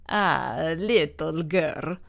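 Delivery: put-on slow, warm Italian accent; thick Italian accent. A narrator's reading. Italian man answering the confused girl, Italian accent. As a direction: drawl it like an old Italian man. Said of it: "he said in a thick Italian accent".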